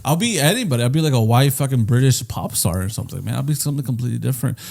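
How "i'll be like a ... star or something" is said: ordinary talk, nothing marked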